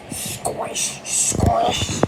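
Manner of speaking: breathy unvoiced squish sound; whispered squish. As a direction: whisper the squelch of mud. Muddy squelch sound effect.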